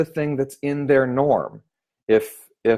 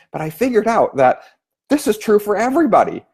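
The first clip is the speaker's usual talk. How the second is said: ordinary talk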